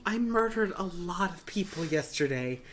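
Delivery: hammed-up high voice asking for reassurance; mock confession. Voices a game character confessing to professor.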